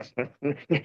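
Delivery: quiet imitated sniffles; mock sniffling. Driver imitating two passengers in his car, soft mock sniffling. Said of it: "They sniffling".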